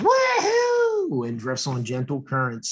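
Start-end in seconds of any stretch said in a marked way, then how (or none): 0.0-1.3 high, thrilled woohoo like riding a wave; excited woohoo. Acted. Drop whooping for the kids as she rides a wave, high excited cheer.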